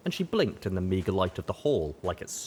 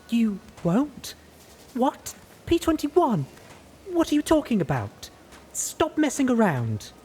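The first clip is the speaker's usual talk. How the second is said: outraged; 4 dB louder than usual. A narrator's reading. Glory outraged at P-21.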